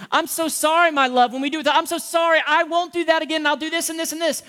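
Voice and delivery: high, loud hurried sorry to a spouse; glib apology. Retold or imitated. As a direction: pile up promises to your love.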